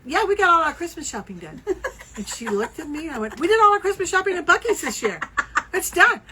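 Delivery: proud brag. Retold or imitated. Bragging to cashier.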